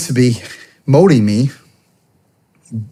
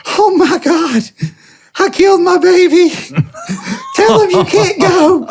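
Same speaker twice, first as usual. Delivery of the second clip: much higher, wailing mock-hysterical mother, laughing through it; hysterical mom. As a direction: wail it like a panicked mom losing her baby. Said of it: "She's, like, all hysterical".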